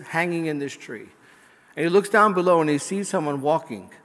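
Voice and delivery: ordinary talk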